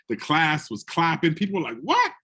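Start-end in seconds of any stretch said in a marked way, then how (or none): 1.8-2.1 sudden high squeak of disbelief; a jolt of total surprise, pitched way up; shocked what. Retold or imitated. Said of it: "everybody's in shock".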